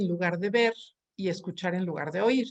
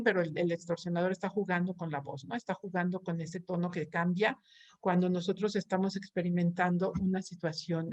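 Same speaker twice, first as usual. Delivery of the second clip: normal talking voice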